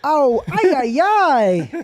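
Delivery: high, loud cartoonish wail; Willy Wonka voice. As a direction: cry out like a silly candy man. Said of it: "the famous Willy Wonka quote".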